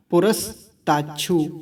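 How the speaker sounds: पुरस्ताच्छु broken after पुरस; pause inside the word. Shown as a demonstration. Teacher splitting a mantra word, पुरस्ताच्छु with a pause after पुरस. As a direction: stop briefly on पुरस, then finish पुरस्ताच्छु. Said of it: "पुरस पे रुकेंगे थोड़ा सा".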